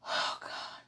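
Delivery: breathless whispered oh God; barely voiced plea to God; a whisper under the breath; whispered prayer. Real, not acted. Overwhelmed, praying alone in bed.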